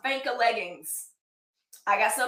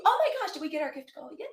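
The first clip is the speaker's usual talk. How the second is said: quick, high, thrilled outburst; real delight at hitting the goal; excited; 6 semitones higher, faster than usual. Real, not acted. Reacting to reaching gift goal.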